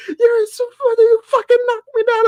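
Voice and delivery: high, loud retold yell at the narrator. Angry shopper yelling at him after being knocked down, loud retold yell. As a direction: bark it back as the angry woman. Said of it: "she was yelling at me".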